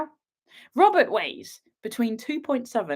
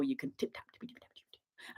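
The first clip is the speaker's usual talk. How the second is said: playful hushed typing noise; whispered tip tap; 7 semitones lower, 17 dB quieter than usual. Pretend. Host to viewers, miming typing in a whisper.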